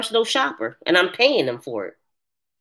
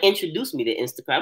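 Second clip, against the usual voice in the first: normal talking voice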